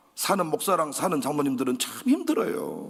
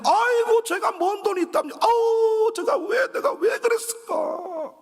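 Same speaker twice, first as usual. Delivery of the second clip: high, loud anguished aigo; self-reproaching wail; 3 dB louder, slower than usual. Retold or imitated. Pastor lamenting asking for money, loud and high. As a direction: cry out in mock regret. Pastor regretting the loan request.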